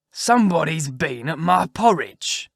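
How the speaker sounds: slow, loud, rough big-bear voice; great rough gruff bear. Acted. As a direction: growl it out slowly as a huge bear. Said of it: "in his great rough, gruff voice".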